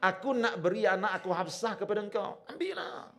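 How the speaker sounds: loud, high-pitched pleading offer; acted insistence. Umar offers his daughter to a silent friend, insistent. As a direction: push the offer on him, voice climbing at the end.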